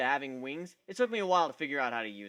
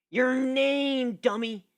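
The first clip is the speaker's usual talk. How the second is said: higher, louder exasperated near-yell; almost yelling; 8 semitones higher, 7 dB louder, much slower than usual. Acted. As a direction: snap it at the thick-headed stranger. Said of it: "She was almost yelling".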